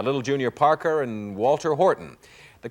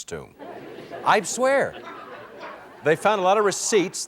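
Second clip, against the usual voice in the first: laughing; 6 semitones higher than usual. Real, not acted. Joking about King Tut's accountant.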